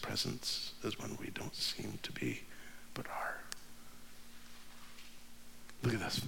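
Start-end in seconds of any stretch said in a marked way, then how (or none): 0.0-3.4 fading into a whisper; soft unvoiced whisper. Real, not acted. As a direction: let it drop to a breath. Whispered point about hidden presence.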